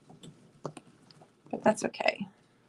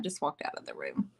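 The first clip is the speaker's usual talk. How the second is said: whisper; 7 semitones lower, 11 dB quieter than usual. Real, not acted. Aside as husband leaves.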